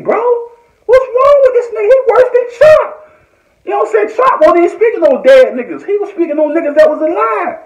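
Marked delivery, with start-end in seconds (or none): 0.9-4.8 high, crying-out what's wrong; exasperated cry. Real, not acted. Venting to viewers about Wack, high anguished cry. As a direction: pitch way up, half-wailing in frustration.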